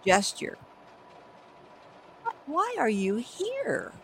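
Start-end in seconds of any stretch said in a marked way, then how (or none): 2.5-3.9 high, slow, gentle question; sincere. Shown as a demonstration. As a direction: ask softly and sincerely. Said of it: "with your most sincere gesture".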